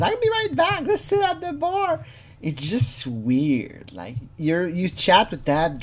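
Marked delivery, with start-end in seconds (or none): 0.0-2.0 very high squeaky mock girl voice; mock decoy girl. Imitating the decoy's response to the visitor, mock high girl voice. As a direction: put on a high-pitched girly singsong. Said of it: "The response that she says".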